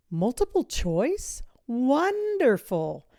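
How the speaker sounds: slow, drawn-out, rising delight. A narrator's reading. Miss V delighted at the multiple-choice test. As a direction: savour the good news.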